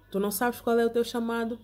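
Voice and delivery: usual speaking voice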